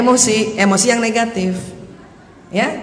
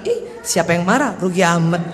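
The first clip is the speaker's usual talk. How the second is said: low, quieter mock denial; softening denial; 7 semitones lower, 4 dB quieter than usual. Wife answering husband's second question, denial starting to soften. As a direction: brush it off, low and half-relenting. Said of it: "udah mulai agak-agak kendor".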